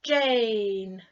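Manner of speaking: "Jane" with a long, held A sound and a silent E. Shown as a demonstration. Teacher modelling "Jane" to learners, long A clearly. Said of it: "Try it".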